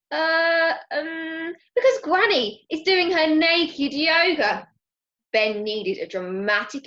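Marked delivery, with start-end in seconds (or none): 0.1-4.7 high drawn-out hesitation, then a loud blurt; stall, then blurt it out; panicked excuse. A narrator's reading. Ben invents an excuse for Mister Parker, panicked.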